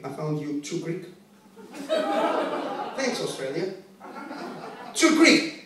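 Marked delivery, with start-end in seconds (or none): 4.9-5.6 high, outraged echo; mock indignation. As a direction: throw the criticism back, offended. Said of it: "I was so angry".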